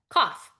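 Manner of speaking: a short throat cough; demo cough. Shown as a demonstration. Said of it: "You could".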